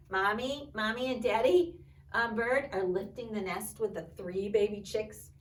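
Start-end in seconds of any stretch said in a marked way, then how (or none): none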